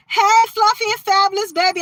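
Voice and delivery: excited shout-out. Real, not acted. Greeting viewer in live chat.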